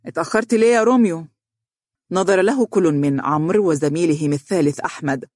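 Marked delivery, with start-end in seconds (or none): 0.1-1.3 high, quick mocking jab; mocking question, pitched up; sarcastic. A narrator's reading. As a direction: tease with open sarcasm. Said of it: "يقول بسخرية".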